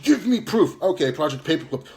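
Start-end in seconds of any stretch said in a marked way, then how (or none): 0.0-0.8 mock his demand in a squeaky jeer; mocking high whine. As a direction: Give me proof said higher and louder in a mocking whine. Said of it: "and you just".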